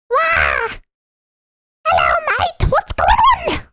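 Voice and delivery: very high squeaky British squeal; British Toad voice. Shown as a demonstration. Attempting the toad voice for a friend, high British squeal. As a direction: screech it like a tiny cockney toad. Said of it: "can't even do the toad no-, voice. It's like".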